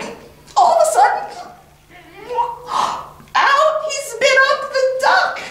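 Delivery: very high, fast, laughing rush; excited climax. A narrator's reading. Storyteller revealing the duck spat out, high and laughing. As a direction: race to the punchline with glee.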